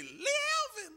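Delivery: very high, falling falsetto call; mother's summoning call. Retold or imitated. Mother summoning her son who went too far, warning call. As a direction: draw the full name out up high. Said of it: "But when she say".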